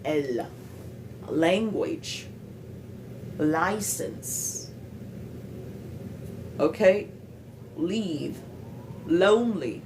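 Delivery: L tapped clearly on the palate, as in Language, Leave, Lonely; one word at a time, crisp L at the start of License; normal L. Shown as a demonstration. Showing learners the normal L, tongue tapping the palate. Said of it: "Vous voyez, ma langue tape sur le palais".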